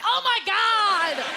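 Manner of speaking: high, slow, loud recoil in horror; horrified kids. Retold or imitated. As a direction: gasp at the scarred face. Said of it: "they'd be like".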